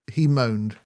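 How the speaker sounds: ordinary delivery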